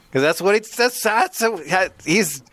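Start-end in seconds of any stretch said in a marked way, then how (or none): none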